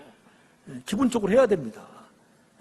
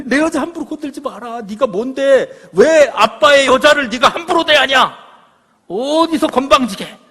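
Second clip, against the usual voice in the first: loud, high-pitched fatherly tirade; acted outraged shouting, building without letup; angry scolding; 8 semitones higher, 11 dB louder than usual. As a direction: tear into your insolent son at full volume. Father scolding son, acted at full force.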